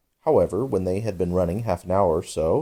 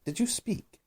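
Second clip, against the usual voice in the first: mouse voice; 7 dB quieter than usual. A narrator's reading. Mouse asks the lory.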